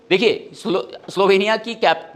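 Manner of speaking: ordinary delivery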